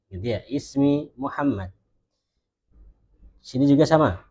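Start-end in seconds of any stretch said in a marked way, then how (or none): 0.5-1.7 slow "Ismi Muhammad" without case ending; gaya percakapan. Shown as a demonstration. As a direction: end "Muhammad" on a closed consonant. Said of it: "kalau kita percakapan ngomongnya". ("Ismi Muhammad", akhirannya dimatikan)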